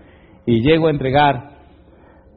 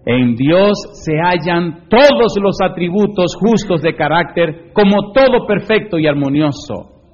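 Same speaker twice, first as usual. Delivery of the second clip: loud, raised voice, key words hit hard; forceful preaching read-aloud; 4 semitones higher, 5 dB louder than usual. Acted. Preacher reads the standard to the congregation, emphatic. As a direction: proclaim the written words to a whole hall.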